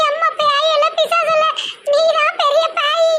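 high giggly taunt; cackle, then drag out the insult; possessed laughing. Acted. Daughter calling mother the bigger ghost, laughing. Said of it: "பேயெல்லாம் சிரிக்குது இப்படி".

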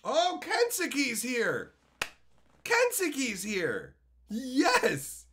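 high, slow, drawn-out greeting; welcoming a viewer, drawn-out and high; excited greeting. Real, not acted. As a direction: stretch it out with delight.

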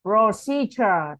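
"Procedure" very slowly, with a clear ch in the middle; teacher stretches out "Procedure" so the ch is heard; ch sound. Shown as a demonstration. Teacher slowly models the word for a student, clear ch. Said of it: "Z mình đọc là chờ".

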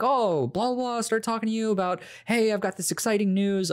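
high, chirpy wife voice; lightly voiced wife. The wife coming out of the bathroom, lightly voiced cheerful wife. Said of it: "will be like".

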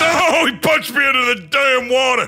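high, loud cry of surprise; indignant yell. Real, not acted. Player punched into the water, shocked yelling. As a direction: holler it as you get knocked flying.

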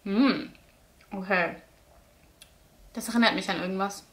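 talking while chewing; tasting ice cream while speaking; mouth partly full. Real, not acted. Seen 1: Chews and talks with spoon in hand, pint in front.

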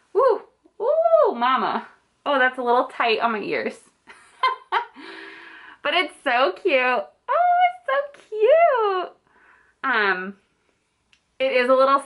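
muffled behind cloth mask; speaking into fabric covering; through a face mask. Real, not acted. Seen 4: Pink rainbow cloth mask covers her mouth and nose throughout.

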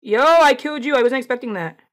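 loud, high burst of surprise; surprised delight. Real, not acted. Player lands an unexpected kill, surprised delight. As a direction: blurt it out, amazed at your own kill.

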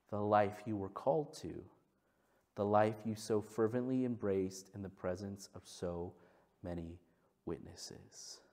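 low, soft, slow solemn reading; solemn scripture read. Acted. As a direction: read it as a tender charge. Reads scripture.

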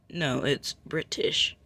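"British" slowed right down with a clear t; correct British. Shown as a demonstration. Correcting a mistaken speaker, slow careful pronunciation. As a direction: insist on "British", slowly sounding out the t. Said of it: "Pronounce it right next time".

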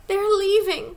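put-on high, loud, urgent child's outcry; breathless, high-pitched little girl crying out; distressed cry. A narrator's reading. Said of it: "she cried".

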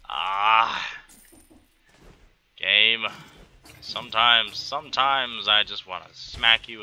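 normal talking voice

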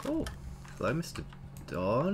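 normal talking voice

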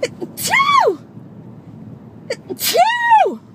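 big loud sneezes. Real, not acted. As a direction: sneeze loudly, twice. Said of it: "I have to sneeze".

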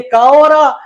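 teacher spells क and अ apart for the class; sounding out the letters. Shown as a demonstration. Teacher spells out क and अ for students. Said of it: "इसको बोलने के लिए मेरे को दो साउंड चाहिए".

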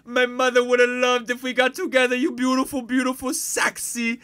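put-on loud, high caricature Indian accent; hammed-up Indian-accented patient pouring out flattery; squeaky, over-the-top Indian voice. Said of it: "what about Indian Sopranos?".

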